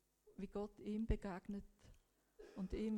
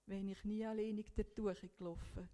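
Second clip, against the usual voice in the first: ordinary delivery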